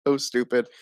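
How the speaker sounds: normal talking voice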